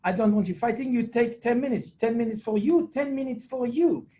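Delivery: high mock-stern referee voice; Didier Mene. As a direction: act out a referee sending players off. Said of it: "He said, uh".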